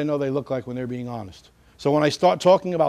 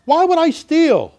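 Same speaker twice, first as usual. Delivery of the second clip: much higher, louder protest of innocence; high lying voice; 12 semitones higher, 9 dB louder, much slower than usual. Shown as a demonstration. Suspect lying about a theft, voice pitched way up. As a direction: voice shooting up in loud, drawn-out disbelief. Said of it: "their voice is gonna go a lot higher".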